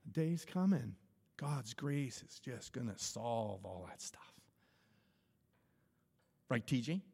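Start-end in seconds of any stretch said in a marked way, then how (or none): 1.4-4.2 hushed whisper. Acted. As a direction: breathe it like a secret promise. Sermon aside, hushed promise.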